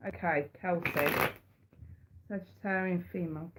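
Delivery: ordinary delivery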